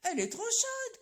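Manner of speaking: put-on high, loud old man's cry. Old man to his wife about the hot galette, high loud cry. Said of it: "crie le vieux".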